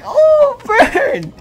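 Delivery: mock burn. Reacting to roast in chat.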